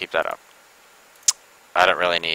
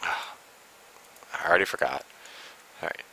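tired whisper; 11 dB quieter, slower than usual. Real, not acted. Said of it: "sighs then whispers".

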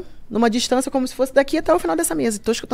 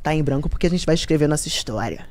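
lower, smooth pickup-line voice; mock seductive man; 9 semitones lower, 6 dB quieter than usual. The guy handing her a blank napkin, in a lightly voiced smooth tone. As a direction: play the cheesy flirt, low and smooth.

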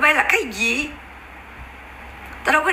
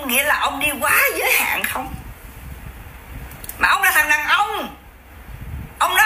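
angry shout. Real, not acted. Angry livestream rant at a critic.

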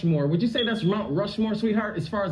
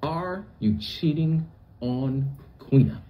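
slow, lower, spaced-out question; deliberate questioning; 8 semitones lower, slower than usual. Retold or imitated. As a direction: spell the question out word by word. Host retells his pointed question.

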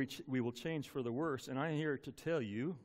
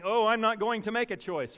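mock refusal; 5 semitones higher, 7 dB louder than usual. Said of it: "those who are saying".

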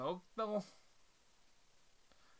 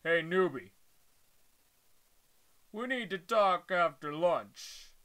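menacing bully; 7 dB louder than usual. Acted. Bully threatens new student.

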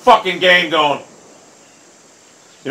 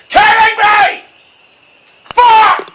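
slow yell building to high scream; furious disbelief; 8 dB louder, much slower than usual. Real, not acted. Gamer raging at a missed putt, furious yelling. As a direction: build outrage into an explosive curse.

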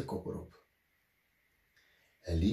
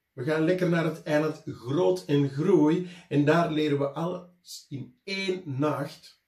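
put-on higher, louder defiant kids; children calling back; 4 semitones higher, 4 dB louder than usual. A narrator's reading. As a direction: shout back stubbornly from the boat. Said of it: "riepen terug". (kinderen roepen koppig terug, hoger en luider)

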